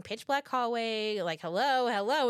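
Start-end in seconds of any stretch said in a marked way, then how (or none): 1.4-2.3 nervous hello, higher and louder; freaked-out calling. Retold or imitated. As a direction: call out uneasily into the unknown. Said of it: "we are freaked the fuck out".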